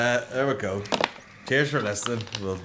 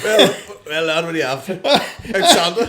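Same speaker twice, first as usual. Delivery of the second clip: louder, jokey dodgy Santa; mock Santa; 6 dB louder than usual. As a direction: play a shady Santa for laughs.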